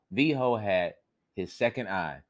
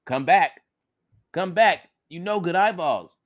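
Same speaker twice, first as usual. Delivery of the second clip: loud, high calling out; desperate calling; 8 semitones higher, 6 dB louder than usual. Acted. Viho yells at his eyeballs stuck in the tree. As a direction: shout after the eyeballs in panic. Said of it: "Viho cried again and again".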